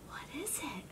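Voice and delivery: fast scared whisper; breathless hushed question; quick unvoiced whisper. A narrator's reading. Spotting something in the yard.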